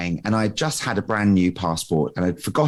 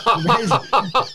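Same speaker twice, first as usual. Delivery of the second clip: high, helpless laugh; burst of laughter; 11 semitones higher than usual. Real, not acted. Guest cracking up telling the airport story, laughing. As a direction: cracks up at his own mishap.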